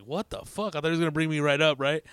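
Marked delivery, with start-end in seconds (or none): 0.0-0.7 muttered disbelief. Retold or imitated. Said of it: "I'm just going like".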